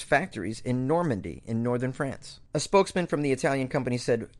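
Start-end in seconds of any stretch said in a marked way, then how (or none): none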